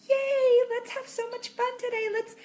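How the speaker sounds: very high, excited kiddie-playtime voice; hammed-up chirpy parent; perky mom voice. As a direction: cheer on small children in a high singsong. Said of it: "I'm talking like this all day".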